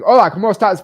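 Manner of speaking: light imitation of a Mexican Spanish accent. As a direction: toss off a Mexican Spanish hello. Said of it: "it sounded like I was from Mexico".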